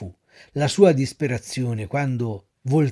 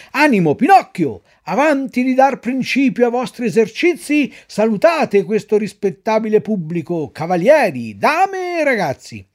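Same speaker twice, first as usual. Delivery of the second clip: loud, high showman's call; ringmaster calling out; 7 semitones higher, 7 dB louder than usual. Acted. Director orders Pinocchio to greet the audience, loud. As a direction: command the donkey before the crowd. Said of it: "gli disse".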